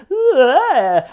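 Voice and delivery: high, loud hammed-up moaning; fake orgasm moan. Actress [name] hams up a grief scene, loud fake moan. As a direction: overact a bad fake orgasm. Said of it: "like an amateur actor faking an orgasm".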